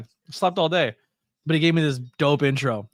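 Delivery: normal talking voice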